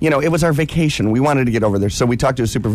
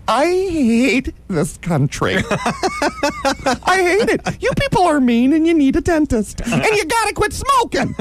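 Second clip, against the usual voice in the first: high camp rant. Retold or imitated. Ranting at the cabbies.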